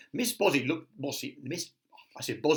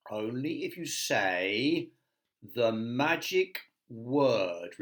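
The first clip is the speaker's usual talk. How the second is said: slow teasing wizard; much slower than usual. Acted. Wizard sets a condition.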